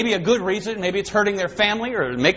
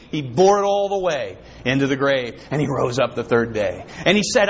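normal talking voice

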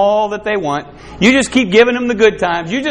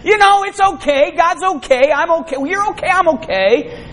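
mock complacent voice; 8 semitones higher than usual. People mocked as complacent.

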